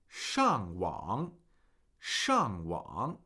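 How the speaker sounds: 上网: drop hard on 上, then dip and rise on 网; tone demo. Shown as a demonstration. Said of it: "Fourth tone, third tone".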